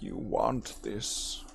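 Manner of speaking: low, slow Emperor Palpatine whisper; tempting devilish whisper. Retold or imitated. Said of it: "it's like Emperor Palpatine".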